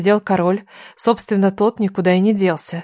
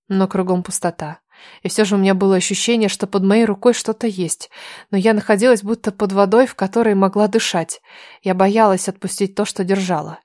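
usual speaking voice

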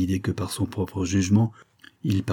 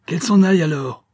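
loud, higher burst of temper; angry outburst; 9 semitones higher, 7 dB louder than usual. A narrator's reading. Husband snapping at his wife, angry. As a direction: throw the words out in rage.

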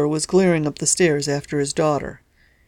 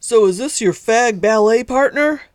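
louder mocking shout upward; shouting up stairs; 5 semitones higher, 4 dB louder than usual. A narrator's reading. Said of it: "shouted up the stairs".

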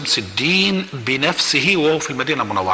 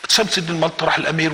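usual speaking voice

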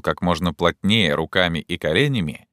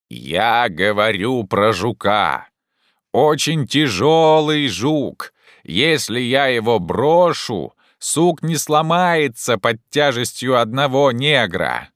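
slow, higher, stretched-out explanation; calling down; 5 semitones higher, 4 dB louder, slower than usual. Acted. Jupiter explains the heavy beetle, calling down. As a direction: draw each phrase out to carry.

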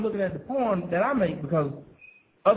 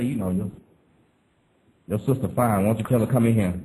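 deep, lazy come-on voice; low bedroom voice; 10 semitones lower than usual. Retold or imitated. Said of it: "but I might say".

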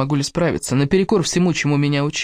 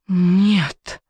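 higher, drawn-out moan of horror; 4 semitones higher than usual. Acted. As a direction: moan it out in anguish. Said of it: "простонала я". (горестный стон)

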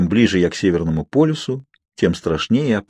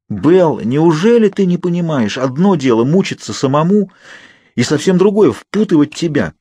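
higher and louder, pleading in pain; anguished plea; 7 semitones higher, 3 dB louder than usual. A narrator's reading. Edward pleads with Bella, higher, louder, anguished. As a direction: agonise over dragging her in.

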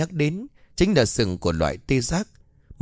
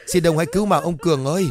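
higher, fast, desperate wailing plea; frantic crying plea; 6 semitones higher, faster than usual. Acted. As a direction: beg faster and more desperately, voice rising. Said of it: "tiếng khóc vang lên".

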